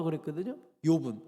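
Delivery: ordinary talk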